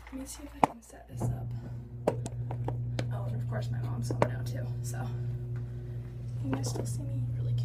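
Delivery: hushed whisper so mom won't hear. Real, not acted. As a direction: whisper it under your breath. Mom home, hiding the noise.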